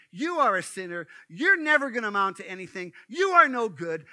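loud accusing devil voice; accusing Satan. Acted. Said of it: "and he says".

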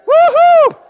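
high, loud celebratory whoop; joyful whoop. Real, not acted. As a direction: cheer out with real joy. Joyful cheer at testimonies.

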